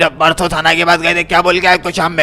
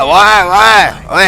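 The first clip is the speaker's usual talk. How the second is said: long drawn-out call; 6 semitones higher, 6 dB louder, much slower than usual. Acted. Yusuf calling after the fleeing man, long loud drawn-out shout. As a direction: stretch out a loud "ओए, ओए" after him.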